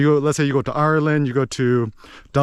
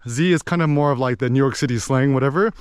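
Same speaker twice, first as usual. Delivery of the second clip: normal talking voice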